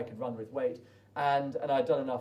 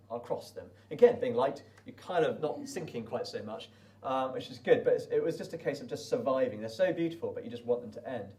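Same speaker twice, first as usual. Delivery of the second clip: ordinary talk, nothing marked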